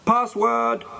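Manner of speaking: high-pitched demanding call; shouted. A narrator's reading. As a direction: call out sharply through a door, high. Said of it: "shouted Peter".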